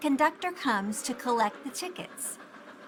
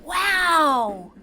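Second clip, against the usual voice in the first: amazed wow; 4 semitones higher, 6 dB louder than usual. A narrator's reading. Pete amazed in caboose.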